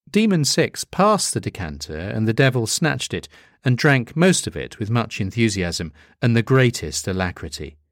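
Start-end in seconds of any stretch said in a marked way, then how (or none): none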